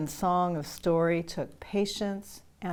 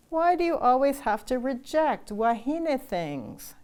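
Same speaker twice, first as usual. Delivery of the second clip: mild accusation; 7 semitones higher, 4 dB louder than usual. Acted. Sisters arguing at dinner.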